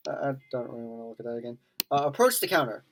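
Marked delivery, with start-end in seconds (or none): 0.1-1.6 quiet fast mumble. Real, not acted. Disgusted by game scene.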